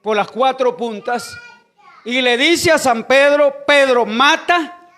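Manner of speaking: loud preacher's delivery with drawn-out key words; preaching. Real, not acted. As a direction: proclaim the Bible story to the congregation. Preaches the story loudly.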